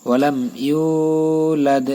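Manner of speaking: ইউলাদ with a long stretched a, slowly; slow, drawn ইউলাদ; drawn yulad. Shown as a demonstration. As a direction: stretch ইউলাদ one alif. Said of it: "এখানে টান দিবেন".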